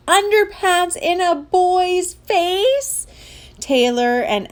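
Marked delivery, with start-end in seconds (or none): 0.1-3.1 high, slow, drawn-out disbelief; mortified kid's rising outcry; horrified yell. Acted. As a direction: stretch each word out, appalled and high. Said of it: "yelled Taylor J".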